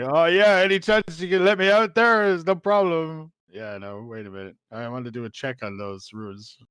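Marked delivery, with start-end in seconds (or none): 0.0-3.3 high, loud, overly innocent; mock sly creature. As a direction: wheedle like a sneaky spirit. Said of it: "It's like".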